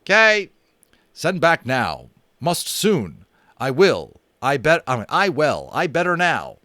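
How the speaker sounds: loud, high, insistent shouting; shouted. A narrator's reading. Little Bear shouting to be sent home at once. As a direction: demand to be sent back now. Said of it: "Little Bear shouted".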